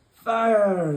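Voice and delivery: very loud, high yell. Acted. Pirate captain yelling the order to fire. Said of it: "the pirate captain yelled".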